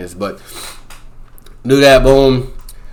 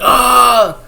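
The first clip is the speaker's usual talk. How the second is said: high scream; 12 semitones higher than usual. Retold or imitated. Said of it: "He's like".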